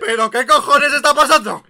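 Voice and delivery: mock shocked yell. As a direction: wake up yelling in disbelief. Antonio waking up shocked. (despertar sobresaltado gritando)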